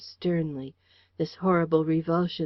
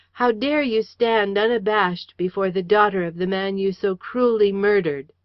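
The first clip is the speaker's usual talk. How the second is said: loud, higher, righteous fury; furious denunciation; 4 semitones higher, 7 dB louder than usual. A narrator's reading. Daughter denouncing her father's murderer, furious. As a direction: denounce him to his face. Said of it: "I cried".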